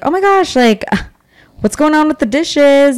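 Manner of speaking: high, playful mock surprise; light and playful. Shown as a demonstration. Roommate gently raising the dirty dishes, light and playful. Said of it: "like, keep it light".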